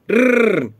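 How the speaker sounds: engine too rich. Shown as a demonstration. Showing a rider how a rich engine sounds, imitated motor noise. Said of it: "trop riche".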